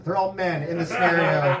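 ordinary talk

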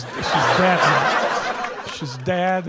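normal talking voice

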